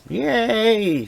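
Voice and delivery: high sarcastic yay; mock cheer. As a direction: cheer it with fake enthusiasm.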